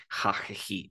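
"ja, je, ji" with a rasped Spanish jota; wrong Spanish J. Shown as a demonstration. Teacher warns against this sound, saying "ja, je, ji" with Spanish jota. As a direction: scrape the J of "ja, je, ji" in the throat. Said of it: "no es una J".

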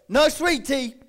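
hammed-up rude customer, high and loud; mock huff. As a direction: huff it out while walking off. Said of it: "as the lady walked away".

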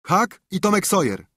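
put-on boy calling back high; answering call. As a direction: call back across the yard.

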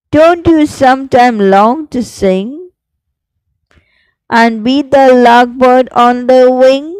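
slow, sing-song recital with rising line ends; poem recitation. Shown as a demonstration. Teacher reciting the lark poem for students, slow sing-song. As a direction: recite it like a class poem, each line lingering.

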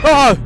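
high, loud yelp of fright; startled cry. Real, not acted. Player hears a sound in the dark, loud yelp. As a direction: jump and yelp. Jump scare.

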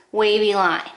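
ordinary talk, nothing marked

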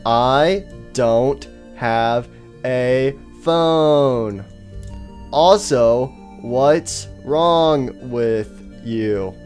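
very slow, drawn-out words; odd halting speech. Acted. Faceless stranger excuses his way of talking, odd halting speech. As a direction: stretch and separate every single word. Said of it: "why do you talk like that?".

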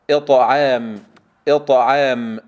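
إطعام loud and deliberate with emphatic ط; loud model of إطعام, long final vowel; strong bounced taa. Shown as a demonstration. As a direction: recite إطعام firmly, bouncing the heavy t. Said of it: "تقرأ هكذا".

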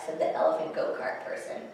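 ordinary delivery

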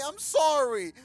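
very high, loud hammed-up sorry; mock apology. Gamer mock apologizing to the zombie, very high and loud. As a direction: overplay the apology.

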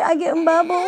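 usual speaking voice

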